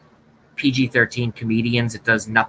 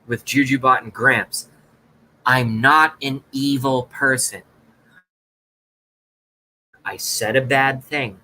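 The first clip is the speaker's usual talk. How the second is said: usual speaking voice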